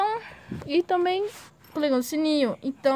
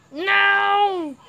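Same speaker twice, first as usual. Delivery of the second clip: loud high cry of frustration; frustrated shout; 9 semitones higher, 7 dB louder than usual. Real, not acted. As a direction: yell at the failed jump. Fails the parkour again.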